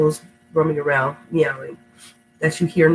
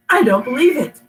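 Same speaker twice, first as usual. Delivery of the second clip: higher and louder, amazed disbelief; excited cry; 6 semitones higher, 5 dB louder than usual. Acted. Nermal cries out at Garfield on TV. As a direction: cry it out in delight. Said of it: "cried Nermal".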